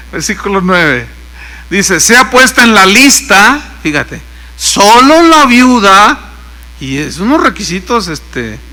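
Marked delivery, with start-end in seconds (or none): 2.0-3.6 loud, slow proclamation; raised, measured pulpit voice; scripture reading. Real, not acted. Reads the verse aloud. 4.6-6.2 loud, very slow proclamation; scripture reading. Real, not acted. Reads the verse aloud.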